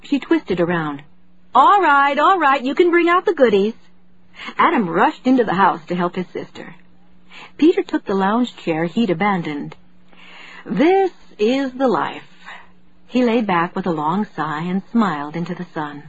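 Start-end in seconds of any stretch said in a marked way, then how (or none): 1.5-3.7 cheerful call. A narrator's reading. Mother calls family to patio. 10.6-12.3 contented, slow. A narrator's reading. Father relaxing in the sun.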